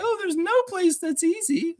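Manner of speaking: very high whiny mimicry; mock a naysayer in a squeaky, high voice; exaggerated high-pitched imitation; mocking stranger impression. Mimics the lady again.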